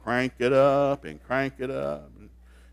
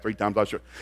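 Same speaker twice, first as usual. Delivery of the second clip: rushed reading; 5 semitones lower, 4 dB quieter, faster than usual. Shown as a demonstration. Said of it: "you're just reading so fast".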